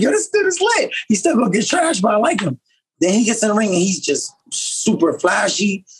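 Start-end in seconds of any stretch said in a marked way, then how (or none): 0.0-2.6 put-on much higher, excited kid voice; hyped reaction. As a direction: gush like a kid hooked by a flashy fighter. His younger self reacting to Roy Jones.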